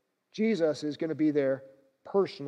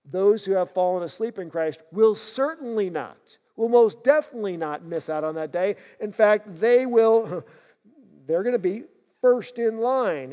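louder and higher as the answer builds; emphatic preaching; 4 semitones higher, 7 dB louder than usual. Acted. Preacher reassuring worried believers, rising emphatic. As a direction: proclaim it with rising force.

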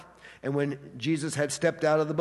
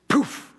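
mock kick noise; 9 semitones higher, 5 dB louder than usual. Imitating kicking a poodle away.

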